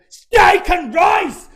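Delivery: very high, drawn-out bellow; full shout. Retold or imitated. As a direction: yell it slowly as if volume translates. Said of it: "Doesn't matter how loud you say it".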